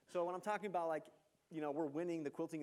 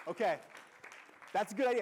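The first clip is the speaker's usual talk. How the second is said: loud, much higher burst of approval; excited praise; 6 semitones higher, 11 dB louder, faster than usual. Real, not acted. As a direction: cheer the answer on.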